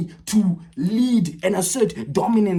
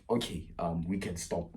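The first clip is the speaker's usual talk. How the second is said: awkward quiet retort; 13 semitones lower, 12 dB quieter than usual. Retold or imitated. Awkward quiet quote to girl.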